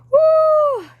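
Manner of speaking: very high, loud "woo" cheer; long high-pitched whoop; shouted whoop. Real, not acted. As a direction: let out a piercing victory whoop. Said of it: "I'm literally shouting on my stream".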